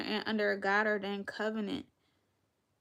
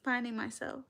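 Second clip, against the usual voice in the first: ordinary talk, nothing marked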